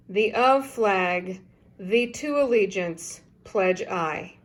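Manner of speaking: slow, careful backwards reading; backwards speech. Shown as a demonstration. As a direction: read each reversed phrase slowly, word by word. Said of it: "So this goes".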